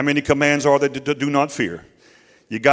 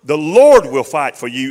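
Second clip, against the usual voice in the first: preaching; 2 semitones higher, 10 dB louder than usual. Real, not acted. Preacher driving it home to the congregation, louder. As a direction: press each line home to the church. Preacher builds to full force.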